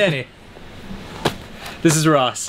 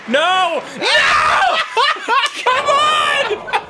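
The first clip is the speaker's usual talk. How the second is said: high, exasperated shouting through laughs; yelled despair breaking into laughter; frustrated yelling; much slower than usual. Real, not acted. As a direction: protest in helpless disbelief. Keeps losing turns in game.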